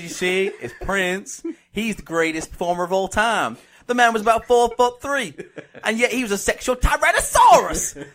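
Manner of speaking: comic Southern American debater; Southern American accent. Pretend. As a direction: make the case in a mock Southern accent. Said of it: "in a Southern American accent".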